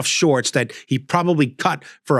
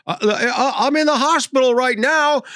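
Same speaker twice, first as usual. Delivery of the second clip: put-on flustered old man; 10 semitones higher than usual. Elderly driver in hospital.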